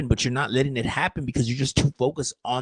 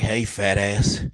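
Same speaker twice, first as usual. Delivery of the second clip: a lightly voiced mocking squirrel; cheeky squirrel voice; 5 semitones lower, slower than usual. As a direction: lower and slow, a sly teasing squirrel. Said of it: "And they're like".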